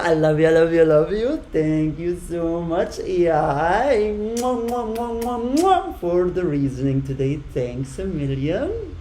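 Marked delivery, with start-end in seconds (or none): 4.2-6.0 1 blown kisses. Real, not acted. Blowing kisses to viewers.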